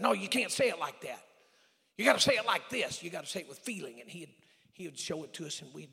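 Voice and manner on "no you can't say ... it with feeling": drama director. Retold or imitated. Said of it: "he's stopping the drama practice. He's saying".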